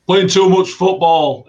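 put-on slow, loud German-accented Klopp line. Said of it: "in a German accent".

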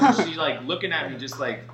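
ordinary talk

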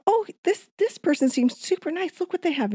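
high, eager gushing voice; excited gushing. Retold or imitated. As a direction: gush over someone new, high-pitched. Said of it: "And I go".

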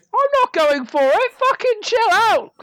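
indignant protest. Acted. Accused of taking the whip, playfully indignant. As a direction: protest in a high, put-upon voice. In-game squabble over a weapon.

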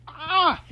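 short high, loud scream; frustrated fangirl scream. Real, not acted. Fan reacts to Ed being looked at, screaming. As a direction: let out an overwhelmed yelp.

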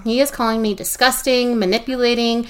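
ordinary talk

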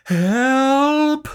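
loud high shout; cry for help. A narrator's reading. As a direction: yell for help. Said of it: "cried Sam".

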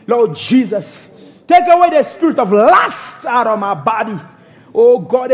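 ordinary talk

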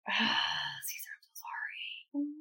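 long, breathy whispered apology; exasperated whisper to the editor. Real, not acted. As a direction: sigh it out in a drawn whisper. Apologizing after repeated failed takes.

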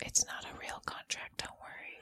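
secretive unvoiced whisper. Real, not acted. Secret aside about contract.

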